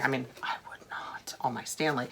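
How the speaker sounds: a quick confiding whisper; whispered aside. Real, not acted. Vlogger confides to viewers about her tumbler, whispering.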